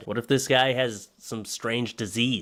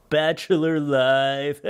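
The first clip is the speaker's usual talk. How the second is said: dramatic slow; 5 semitones higher, 4 dB louder, much slower than usual. Acted. Mock-solemn caption reading.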